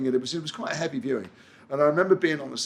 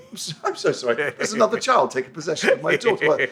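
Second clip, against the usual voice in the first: higher-pitched mock alarm; mock astonished apology; 4 semitones higher, 4 dB louder than usual. Father hears daughter's strange school accent, mock astonishment. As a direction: play the baffled dad, teasing.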